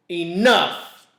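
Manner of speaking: exasperated father calling a halt; loud growl. Acted. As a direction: bark it to end the fight. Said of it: "Dad growled loudly".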